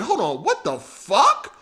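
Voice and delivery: slow hold on, then a high laughing squeal; stunned, half laughing; retold disbelief. Streamer retelling his shock at the likes, stunned and laughing. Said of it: "I'm like".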